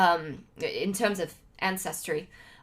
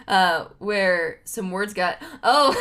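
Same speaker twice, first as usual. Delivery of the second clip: ordinary talk, nothing marked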